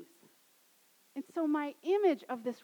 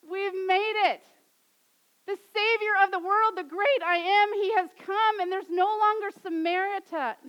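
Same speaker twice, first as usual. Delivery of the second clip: higher and louder, exultant; breathless, overjoyed announcing; joyful proclamation; 6 semitones higher, 7 dB louder than usual. Retold or imitated. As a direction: shout the good news to the whole town. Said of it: "she's running back and she's saying".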